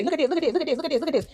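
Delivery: high, repetitive mock tattling; mock pointing-out. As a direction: chant it like an eager tattler. Mimics tattlers pointing her out.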